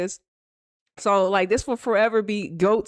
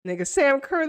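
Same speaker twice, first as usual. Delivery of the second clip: ordinary delivery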